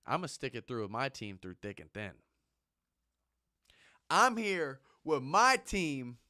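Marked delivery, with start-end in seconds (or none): 4.1-6.2 loud, slow, high proclamation; proud declaration. Real, not acted. Vowing loyalty to his team, slow and loud.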